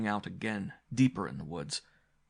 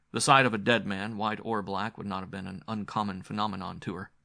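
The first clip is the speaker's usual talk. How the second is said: plain reading voice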